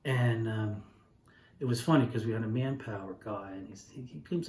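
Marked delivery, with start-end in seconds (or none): none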